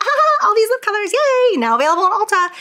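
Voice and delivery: chirpy beauty-influencer promo; mock bubbly ad voice. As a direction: hype up the lipsticks like a cheesy ad. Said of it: "being like".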